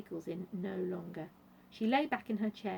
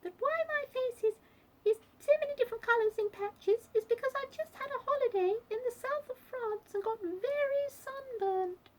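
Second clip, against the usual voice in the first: very high squeak throughout; squeaky Duchess; 4 dB louder than usual. A narrator's reading. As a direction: keep up a high, squeaky explanation. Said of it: "she squeaked".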